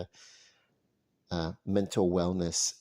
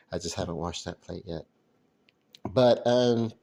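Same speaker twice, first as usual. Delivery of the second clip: normal talking voice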